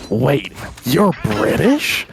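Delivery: slow, drawn-out American surprise; imitating an American accent, slowed way down; mock American accent. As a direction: drawl it like a startled American tourist. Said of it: "I get, like, the American".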